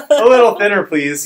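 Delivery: put-on high, loud picky old customer; fussy old person. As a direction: whine it like a fussy oldster.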